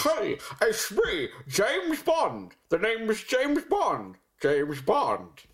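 slow, high, slushy spy send-up; badly faked lisping Scottish spy; Sean Connery. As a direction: announce it slowly with overblown sh sounds. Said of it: "want me to do mine".

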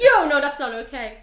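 sudden loud, firm no; a firm loud no to Carrie; loud refusal. Retold or imitated. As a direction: push her off with a loud no. Brody pulls back, refusing.

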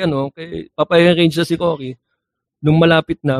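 ordinary talk

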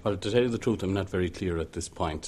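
ordinary talk

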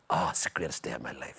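low, voiceless awed whisper; imitating an overjoyed brother in a whisper; whispered rapture. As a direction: breathe it out in hushed bliss. Brother's retold joy, whispered.

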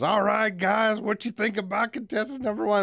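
high, loud, cheesy contest host; flirty teasing dating host. Retold or imitated. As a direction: work the crowd like a sleazy emcee. Wet T-shirt contest host.

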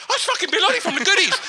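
fast, high excited gabble; quick high squeal of recognition; excited crowd. Retold or imitated. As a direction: blurt it like a starstruck passer-by. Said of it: "Now everyone's going".

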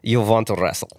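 a lightly voiced Russian bear accent; mild Russian bear growl. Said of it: "Or a bear in Russia".

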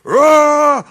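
big bear roar. Retold or imitated. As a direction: bellow like a charging bear. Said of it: "he just goes".

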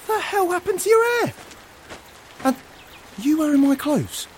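loud, high-pitched indignant questions; shocked disbelief. Acted. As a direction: demand an explanation, voice leaping up in astonishment. Shocked at friend's hair and borrowed clothes.

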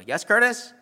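chirpy "Yes, Curtis?" up high; teacher calling on him. Retold or imitated.

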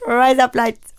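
put-on Indian accent. Shown as a demonstration. Showing co-host the better version, Indian accent. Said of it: "much better in an Indian accent".

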